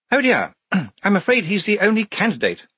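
high-pitched, apologetic officer; Major Clogston, apologetic. A narrator's reading. Officer with only one candidate to show, apologetic and high. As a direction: break it awkwardly in a high voice. Officer breaks awkward news.

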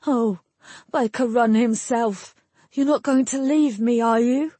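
high, pleading lover's voice. A narrator's reading. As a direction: beg her, rising into the question. Perrin pleads with departing lover.

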